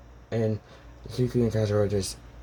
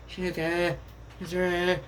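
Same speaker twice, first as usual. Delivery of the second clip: high, sing-out call of names; 8 semitones higher than usual. Acted. Girl walks out of the cabin looking for them, calling out. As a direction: call to them in a raised pitch.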